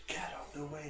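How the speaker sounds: hushed get out the way; whispered. Real, not acted. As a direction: whisper it urgently. Unvoiced whisper.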